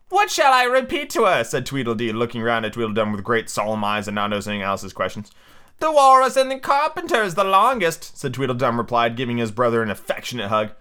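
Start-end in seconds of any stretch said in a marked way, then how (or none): 0.1-1.4 high storybook twin; high-pitched character question; Tweedledee. Acted. Tweedledee speaking, acted. 5.8-8.2 high storybook twin; high-pitched, put-on character voice; Tweedledum. Tweedledum speaking, acted.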